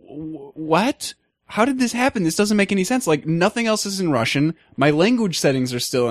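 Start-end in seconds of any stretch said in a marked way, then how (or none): 0.1-3.1 confused surprise. Retold or imitated. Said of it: "And I was like".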